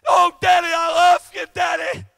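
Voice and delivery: preacher voicing kids who scream to their lost father; children screaming. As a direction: beg daddy, shouting up from heaven. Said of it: "I imagine them kids screaming out saying".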